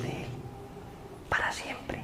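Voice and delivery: breathy whisper, barely there. Acted. Preacher whispering to the congregation about eternity. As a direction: let the words fade into a hush. Eternal separation from God, hushed.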